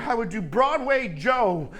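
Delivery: normal talking voice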